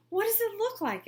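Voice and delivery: bursting to know; eager question. A narrator's reading. Principal eagerly asking Roger about the suit, high and curious. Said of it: "asked Mister Bundy".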